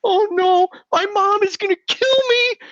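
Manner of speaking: put-on high, panicked kid voice; mock panic. Retelling his boyhood panic to the host, high and frantic. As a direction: squeal it like a terrified schoolboy.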